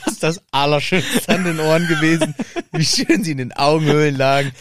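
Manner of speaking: giggling through the words; can barely keep a straight face; laughing. Real, not acted. (lachend, kichernd über die eigenen Worte)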